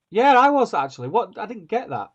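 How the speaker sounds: high and loud, baffled; confused outburst. Real, not acted. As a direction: burst out in mock bewilderment. Confused by the teaser.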